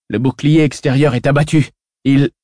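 shouted report. Acted. Officer shouting to everyone that the outer shield fell, loud alarm. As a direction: call it out over the noise. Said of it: "cria l'un des officiers".

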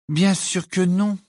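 slow, high, distracted reply; absent-minded. A narrator's reading. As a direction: answer while busy with something else. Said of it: "dit Hermione d'un air absent". (distraite, lente, voix aiguë)

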